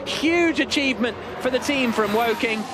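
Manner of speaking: ordinary talk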